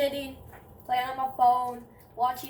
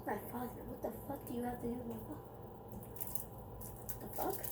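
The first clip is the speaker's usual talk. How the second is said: hushed retort; 13 dB quieter, faster than usual. Retold or imitated. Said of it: "I'm like".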